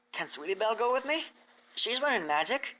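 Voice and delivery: a small hopeful plea; filly asking if her friend can come; Applebloom's voice. Acted.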